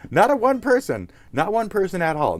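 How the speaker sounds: high, loud hammed-up insistence; exaggerated squawk of protest; mock outrage. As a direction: shoot up high in comic indignation. Emphatic absurd accusation, played up.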